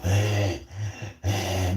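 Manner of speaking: imitated creepy panting; exaggerated pant, like drooling; heavy breathing. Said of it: "breathing heavy, fucking drooling".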